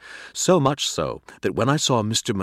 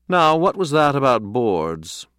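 slow, higher, louder probing question; deliberate questioning; 6 semitones higher, 6 dB louder than usual. A narrator's reading. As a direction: draw the question out pointedly. Cat asks slowly, deliberately.

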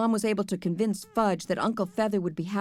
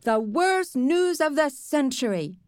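slow, high mocking mimicry; Sheila; 6 semitones higher, 3 dB louder, slower than usual. A narrator's reading. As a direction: exaggerate the girl's outcry, drawn out. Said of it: "doing a perfect imitation of Sheila".

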